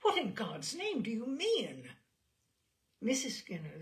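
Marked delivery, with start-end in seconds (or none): 0.0-1.9 horrified demand. Acted.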